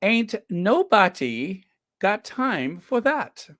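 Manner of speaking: badly faked English accent, high and slow. Shown as a demonstration. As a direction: put on a hammed-up English accent, drawn out. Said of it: "more interesting with the English accent".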